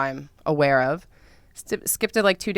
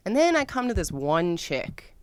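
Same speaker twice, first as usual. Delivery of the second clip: ordinary talk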